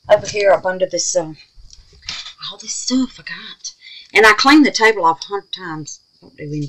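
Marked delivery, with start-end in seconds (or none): 2.5-3.7 unvoiced aside to herself; whisper. Real, not acted. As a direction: breathe it out under her breath. Muttering over cluttered craft table.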